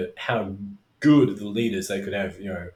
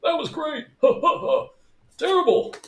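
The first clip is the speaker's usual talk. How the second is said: high mocking old-man heckler voice, fake laugh in the middle; cackling high old-man put-down; Statler and Waldorf heckle; 7 dB louder than usual. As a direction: heckle like a grumpy Muppet from the balcony. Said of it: "I feel like Statler and Waldorf".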